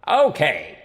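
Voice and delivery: loud, projected stage okay; mayor addressing crowd. A narrator's reading. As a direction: call the crowd to attention.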